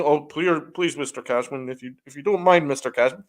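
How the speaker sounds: mocking a soft boss who begs politely; mock meek pleading. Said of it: "Hal is so soft".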